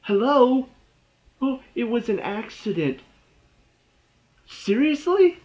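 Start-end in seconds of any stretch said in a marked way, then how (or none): 0.0-0.7 incredulous hello. Real, not acted. Exasperated at character's logic. 4.5-5.4 high, loud disbelieving seriously; can't believe what you just heard; incredulous seriously. Real, not acted. Reviewer exclaims at the show's plot, high incredulous.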